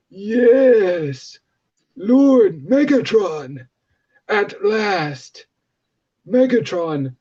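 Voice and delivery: theatrical aged villain, very drawn out; Megatron impression. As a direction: drag each phrase out like an ancient warlord. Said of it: "And I'm gonna try to do his voice".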